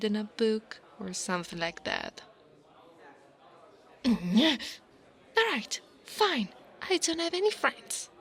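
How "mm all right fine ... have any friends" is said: high, louder, huffy concession; grudging, frustrated confession; exasperated admission. Acted. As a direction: give in with an exasperated outburst. Cornered, grudgingly admits having no friends.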